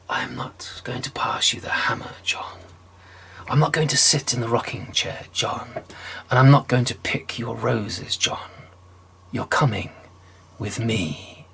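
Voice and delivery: cold whispered refusal; Death's whisper. Acted. Death refusing John's tricks, cold whisper. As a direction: whisper each no coldly.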